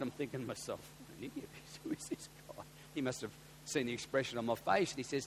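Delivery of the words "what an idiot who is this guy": whispered aside with a stifled laugh; muttered thought. Retold or imitated. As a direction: breathe it like a private thought, half laughing. Private thought about the ferry man.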